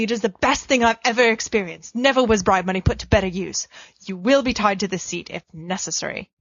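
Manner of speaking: usual speaking voice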